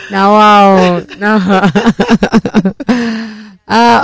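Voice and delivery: ordinary delivery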